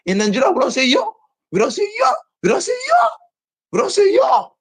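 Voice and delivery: exasperated scolding rant; high emphatic insistence. Real, not acted. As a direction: hammer the point home, voice climbing. Repeated emphatic correction, high pitch.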